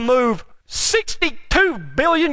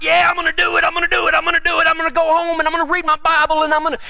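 higher, eager rattling off of resolutions; hyped churchgoer promising to be good, imitating; mock pumped-up resolve; 6 semitones higher, faster than usual. Churchgoer fired up after church, mock eager.

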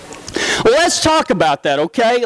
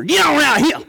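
cowboy drawl; 7 semitones higher than usual. Retold or imitated. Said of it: "fancy words that we run all together".

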